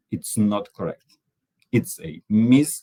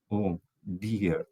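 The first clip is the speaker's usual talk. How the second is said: soft and sweet, much softer; 8 dB quieter than usual. Shown as a demonstration. Speaker demos a sweet, soft "Oh, dear". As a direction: say it tenderly, like an endearment. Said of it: "so it's sweet".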